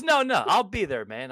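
loud, high airhead voice of a friend; clueless Marky. Retold or imitated. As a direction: cheerfully ask what you should already know. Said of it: "He goes".

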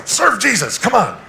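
high, loud mock nagging; loud urging. Pastor nagging friend to serve, loud and high. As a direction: nag him loudly to serve.

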